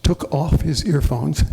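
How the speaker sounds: ordinary talk, nothing marked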